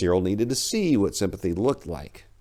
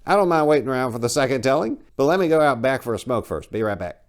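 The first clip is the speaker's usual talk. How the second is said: put-on higher, louder eager old priest; Father Denham, jovial priest; 7 semitones higher, 6 dB louder, faster than usual. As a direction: gush like a cheerful, pushy old priest. Denham pushes past.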